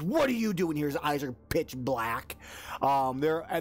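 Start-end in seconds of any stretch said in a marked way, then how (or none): 0.0-0.9 high, angry challenge; berserk crime boss. Acted. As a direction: turn on an intruder, pitched up sharply. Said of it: "going berserk".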